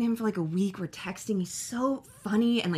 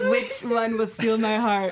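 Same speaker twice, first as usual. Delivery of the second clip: hammed-up romantic line; 3 dB louder, slower than usual. Bachelor contestant parody.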